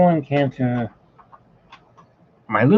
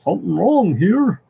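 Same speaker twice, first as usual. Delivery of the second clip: a louder, higher imitation of the old Goofy line; hint of the original Goofy, pitched up; 6 semitones higher, 5 dB louder than usual. Quoting the voice actor doing the original Goofy, mildly higher and louder. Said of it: "he had this voice that was like".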